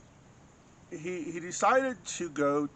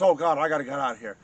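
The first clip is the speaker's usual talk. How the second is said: panicked; 8 dB louder, faster than usual. Retold or imitated. Retold man panicking, wants to flee.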